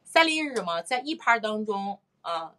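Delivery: ordinary talk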